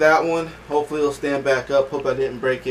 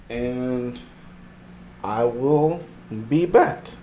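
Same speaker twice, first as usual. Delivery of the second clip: ordinary delivery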